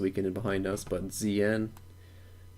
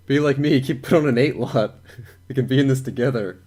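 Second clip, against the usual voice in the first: laughing; 4 semitones higher, 8 dB louder than usual. Real, not acted. Laughing while joking about lot size.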